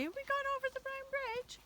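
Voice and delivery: very high, soft pretend dog voice; dog's voice. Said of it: "come and talk to us and say".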